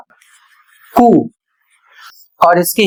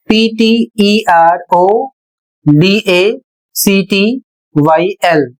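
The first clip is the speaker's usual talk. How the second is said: letters of पीटीईआरओडीएसीटीवाईएल read very slowly; spelled out slowly; much slower than usual. Shown as a demonstration. Teacher spelling the word slowly for students. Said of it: "यहाँ पर मैंने बताया PT".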